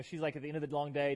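ordinary delivery

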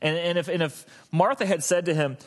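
normal talking voice

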